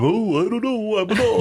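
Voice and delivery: mock dopey dog impression; dumb dog. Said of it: "[name] is dumbing down the dog's knowledge".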